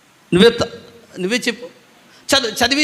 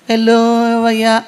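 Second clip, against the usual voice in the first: drawn-out mimicry of the congregation; mocking weak response; 2 semitones higher, 6 dB louder, slower than usual. Preacher teasing congregation over their hallelujah, mocking drawl. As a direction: parody their slurred hallelujah, slowly. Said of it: "అన్నట్టు ఉంది నాకు".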